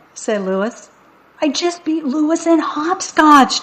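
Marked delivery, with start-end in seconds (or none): 1.4-3.6 put-on high, girlish crowing voice; proud announcement. A narrator's reading. Jenny tells everyone she won at hopscotch, announcing. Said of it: "Jenny announced".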